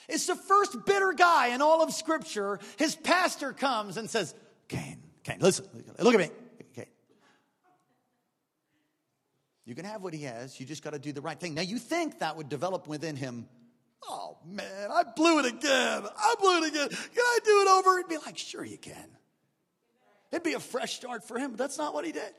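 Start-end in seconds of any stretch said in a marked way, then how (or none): 4.7-11.5 gentle pastor voice. Acted. 14.1-18.0 starts choked, rises to a loud desperate shout; regret building to a cry. Acted. 18.4-19.2 soft whisper, much lower; whispered reply. Acted. God's gentle reply.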